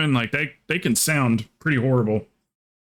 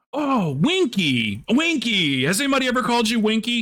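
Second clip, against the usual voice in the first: higher, sing-song taunt of Winky; imitating a teasing kid, higher and sing-song; mocking tease; 10 semitones higher than usual. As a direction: mimic the kids teasing the nickname. Mocking kids teasing his name.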